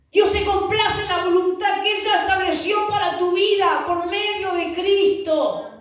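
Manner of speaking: high, ringing sermon declaration; fervent proclamation. Real, not acted. Preacher proclaiming God's will through Christ, fervent. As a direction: proclaim it high and stretch the final phrase.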